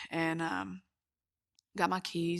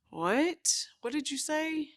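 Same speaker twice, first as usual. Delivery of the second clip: shocked disbelief; 9 semitones higher than usual. Retold or imitated. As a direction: ask it back as if you misheard terrible news. Hearing of sister's death.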